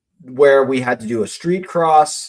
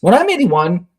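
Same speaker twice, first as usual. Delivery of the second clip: lightly imitated daughter, pitched up; a hint of a hopeful kid's higher voice; child asking; 5 semitones higher than usual. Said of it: "but she'll be like".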